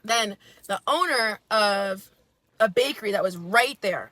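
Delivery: ordinary delivery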